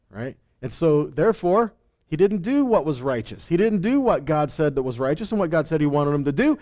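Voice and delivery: ordinary delivery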